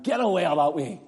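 a light Northern Irish dialect turn. Retold or imitated. Preacher giving the Northern Ireland version of God forbid, light Northern Irish accent. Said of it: "would say again in Northern Ireland".

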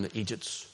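idiots said the Northern Ireland way, as eejits; Northern Irish word. Shown as a demonstration. Preacher teaching American friends the Ulster word idiots, as eejits. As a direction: give idiots its local Ulster sound, eejits. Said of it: "I'll say it in Northern Ireland term".

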